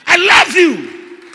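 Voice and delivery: high, loud, drawn-out cry; slow, full-voiced shout; Peter's passionate declaration. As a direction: shout devotion to the Lord, slowly. Acts out Peter declaring love to Jesus.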